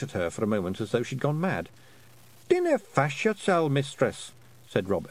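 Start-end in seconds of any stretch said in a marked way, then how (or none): 2.5-4.3 high Scottish pictsie voice, reassuring; Feegle leader. A narrator's reading. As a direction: brush off the danger in a high Scots voice. Feegle leader answers in character voice.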